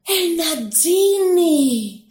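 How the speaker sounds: drawn-out, high cry of wonder; awestruck, stretched-out gasp of delight; ecstatic exclaim. Acted. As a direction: marvel at the sight, slowly. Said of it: "αναφώνησε εκστασιασμένος".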